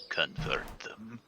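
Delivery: hushed menacing whisper. Acted. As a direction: breathe it out ominously. Creature voice whispering.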